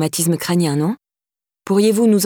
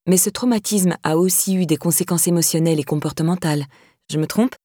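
plain reading voice

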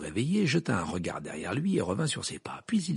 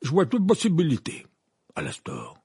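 Fudge; 6 semitones higher, 5 dB louder than usual. A narrator's reading. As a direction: answer officiously, dropping at the end. Narrator voices Fudge replying.

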